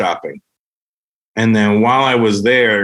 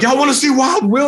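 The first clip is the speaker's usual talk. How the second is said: hype MC call. Retold or imitated. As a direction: rev up the room like an MC. MC hyping the crowd.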